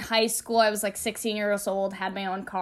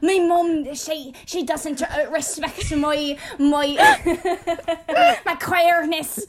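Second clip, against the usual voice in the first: raised, loud, hammed-up movie-heroine outcry through laughter; mock film drama; 7 semitones higher, 5 dB louder than usual. Sixteen-year-old hams up sobbing in a field like a film heroine. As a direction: play it like the big tearful scene of an indie film. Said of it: "very dramatic".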